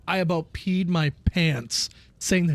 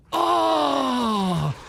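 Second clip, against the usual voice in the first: pained cry; 6 semitones higher than usual. Retold or imitated. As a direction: yelp as if hit below the belt. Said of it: "He was like".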